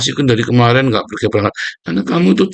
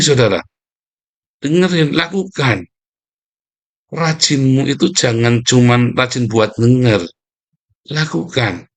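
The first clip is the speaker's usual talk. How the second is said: ordinary delivery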